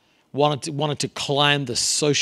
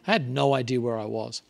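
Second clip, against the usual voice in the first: usual speaking voice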